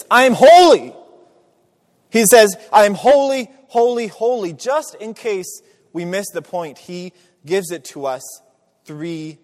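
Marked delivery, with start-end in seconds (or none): 0.1-4.5 high, loud proclamation of "I am holy"; God's proclamation. Acted. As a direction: ring it out like a divine declaration. Voicing God declaring holiness.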